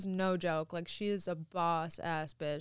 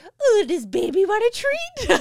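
put-on high, loud, slow baby talk; hammed-up pet coo aimed at a grown-up; weird voice; 13 semitones higher, 12 dB louder than usual. Offering partner a treat like a dog, weird high voice. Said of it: "like, a weird voice".

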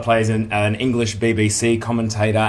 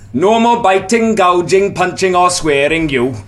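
loud, high Welsh referee shout; Welsh accent; 8 semitones higher, 7 dB louder than usual. Shown as a demonstration. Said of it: "Well, go on then".

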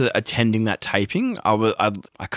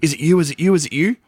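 pushy reporters; 6 semitones higher, faster than usual. Retold or imitated. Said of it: "brutally harassed, being like".

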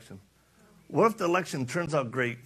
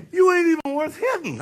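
tough guy line, much higher and louder; loud mocking big-guy voice; dismissive tough guy; 9 dB louder than usual. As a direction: sneer it like a bouncer brushing off a punk. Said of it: "The big guy was like".

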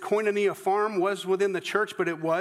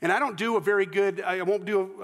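normal talking voice

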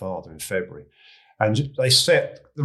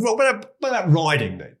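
raised, much higher voice of a landed gentleman; country gentleman; 10 semitones higher, 5 dB louder than usual. Retold or imitated. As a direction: play the toff squire leaning in with a question. Deputy chairman asks again.

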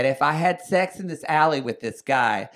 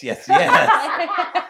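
laughing yes; 9 dB louder than usual. Retold or imitated. Giving in, laughing.

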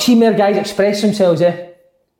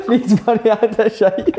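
laughing repeat; slower than usual. Real, not acted. Repeating a line while laughing.

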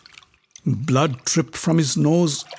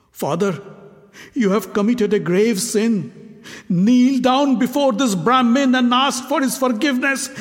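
urgent plea; 8 semitones higher than usual. Acted. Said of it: "Uttar's urgent plea".

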